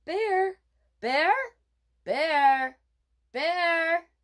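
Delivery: loud, high calls for the bear; yelling. Acted. Boy calling for a bear in the woods, yelling. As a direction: shout it out into the woods. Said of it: "he kept yelling".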